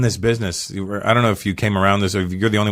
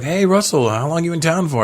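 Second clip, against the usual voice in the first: mock Hollywood greeter; 8 semitones higher than usual. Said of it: "people are like".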